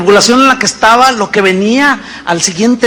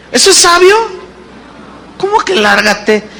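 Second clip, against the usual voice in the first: incredulous; 8 semitones higher than usual. Real, not acted. Pastor mocks the husband's answer.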